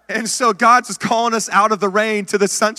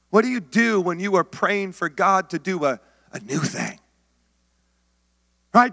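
normal talking voice

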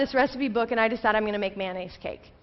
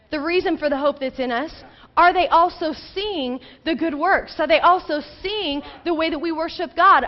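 raised, urgent preacher's voice; fervent preaching; 5 semitones higher, 3 dB louder than usual. Real, not acted. Preacher challenging the congregation on good works, fervently. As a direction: drive home each question with higher, insistent emphasis.

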